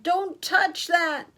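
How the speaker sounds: high, loud, slow warning; yelled warning. A narrator's reading. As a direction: yell to stop your child. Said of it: "Her father yelled".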